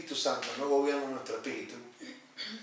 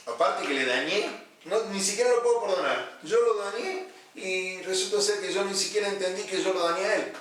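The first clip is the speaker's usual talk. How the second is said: higher-pitched protest, acted out; indignant self-justification; 7 semitones higher, 5 dB louder than usual. Pastor voicing one who cannot forgive, higher indignant protest. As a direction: act out someone refusing to forgive. Pastor voices an unforgiving person's reasoning.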